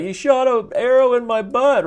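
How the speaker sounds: high squeaky mock complaint; red-haired guy. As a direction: whine it up high like a wounded soldier. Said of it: "the red-haired guy's like".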